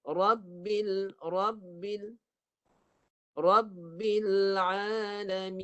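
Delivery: "Alamin" drawn out very slowly, final nun hummed into the nose; Quran recitation with ghunnah. Shown as a demonstration. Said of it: "suara naik ke rongga hidung".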